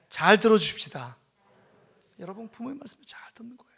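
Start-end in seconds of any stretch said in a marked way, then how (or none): 2.2-3.7 whisper. Acted. Whispered aside in sermon.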